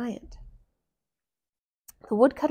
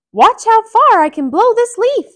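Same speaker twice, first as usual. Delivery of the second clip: Mickey voice; 13 semitones higher, 15 dB louder than usual. Acted. Mickey speaks.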